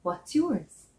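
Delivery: ordinary delivery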